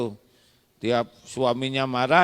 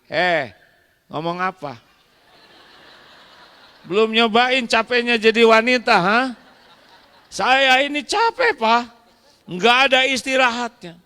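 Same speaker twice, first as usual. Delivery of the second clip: higher and louder, shouting back; angry wife; 9 semitones higher, 5 dB louder than usual. Acted. Wife shouting back at husband, furious. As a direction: fire back as an exhausted wife.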